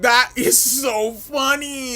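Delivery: slow laughing exclaim. Real, not acted. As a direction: stretch it out through laughter. Laughing at the clip.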